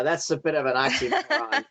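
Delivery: usual speaking voice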